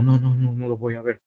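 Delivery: pleading quietly like a terrified prisoner; retold trembling plea. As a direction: captive promising not to look, softly afraid. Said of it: "Y yo le decía temblando".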